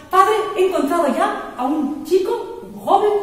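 high, loud eager announcement; a son calling out to his father with his find, excited and loud; excited news. Acted. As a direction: burst in with the good news. Son excitedly tells blind father.

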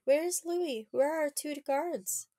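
loud, high call for the missing guards; a frightened girl shouting her question; alarmed cry. Acted. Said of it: "Madeline cried".

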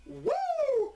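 high, shrieking wrestler's woo; a strutting champion's woo; Ric Flair woo. Acted. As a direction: let out the signature Flair woo. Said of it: "Ric Flair would break into my house".

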